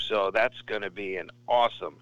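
usual speaking voice

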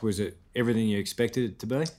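ordinary delivery

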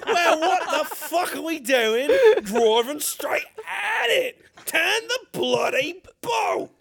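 hammed-up British outrage. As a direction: exclaim in a comic British accent. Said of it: "Can we do my British voice again?".

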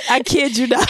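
usual speaking voice

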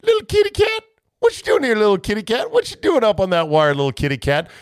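mock the coach sweet-talking a cat; squeaky "little kitty cat" call, falling back toward normal; imitating a kitty call. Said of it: "And I told our players".

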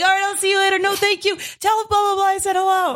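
mayor mode farewell. Retold or imitated. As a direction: gush goodbyes at everyone leaving. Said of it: "'cause you're like".

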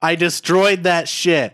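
loud, high, fed-up yell; exasperated shout. Acted. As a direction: snap at a nagging grandpa. Frodo snapping at Bilbo.

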